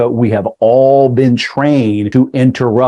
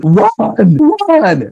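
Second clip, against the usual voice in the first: high-pitched acted warning cry; high, drawn-out cry of alarm; mock yelling; 7 semitones higher than usual. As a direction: imitate an urgent yell without full volume. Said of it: "things that were yelling at you".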